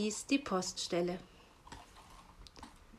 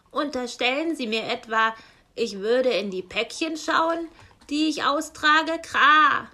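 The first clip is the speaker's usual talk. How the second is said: louder, offended raven caw; slightly indignant; 4 semitones higher, 9 dB louder than usual. Acted. Raven postman rebuffs the owl, loud and indignant. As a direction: bristle at the accusation, cawing. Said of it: "war der Rabe leicht ungehalten".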